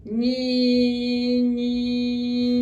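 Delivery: ÑI held very long on one even note; steady, unbroken hum on ÑI; sustained letter sound. Shown as a demonstration. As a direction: keep ÑI droning until the sounds meet. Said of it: "Pronunciando este sonido hasta que choquen".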